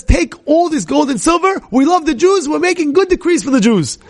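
high, elated proclamation; delighted emperor. Retold or imitated. Acts out delighted emperor's decree.

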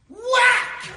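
loud, high shouted whack like a blow landing. Acted. Preacher acting out the bat blow, shouted whack. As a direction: slam the word like the bat hitting.

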